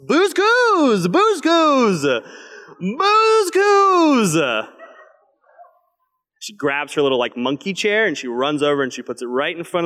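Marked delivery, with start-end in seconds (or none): 0.1-4.7 put-on high, loud toddler shout for her show; toddler yelling. Father imitating his toddler yelling for her show, high and loud. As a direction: shriek it like a demanding toddler. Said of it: "yelling at the top of her lungs".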